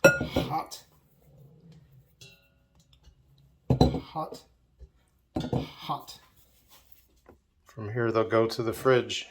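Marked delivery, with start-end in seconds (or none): 0.0-6.2 pained yelps. Real, not acted. Handling eggs straight off the steam.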